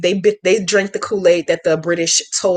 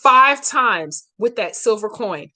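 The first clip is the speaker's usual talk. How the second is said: slowly; slower than usual. Shown as a demonstration. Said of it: "let me say it again slowly".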